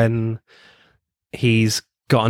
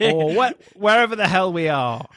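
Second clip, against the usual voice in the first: much higher, showy stage greeting; 9 semitones higher than usual. Retold or imitated. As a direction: camp it up to the crowd. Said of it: "in his [name] costume and says".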